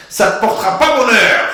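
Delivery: put-on loud, high Moroccan-accented scolding; Moroccan father's voice. Father in Beverly Hills scolding his son, Moroccan accent. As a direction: bark it like an old Moroccan dad. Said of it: "je me surprends à parler comme mon père".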